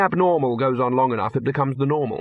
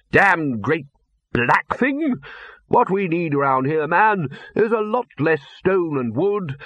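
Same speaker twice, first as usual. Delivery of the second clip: put-on high, hearty old wizard holding forth, slowing on his points; booming old master with opinions on decor; blustery Arch-Chancellor; 5 semitones higher, slower than usual.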